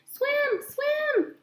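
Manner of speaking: put-on very high squeaky baby goose chirp; excited gosling. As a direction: chirp it like an eager little gosling. Gosling repeats word.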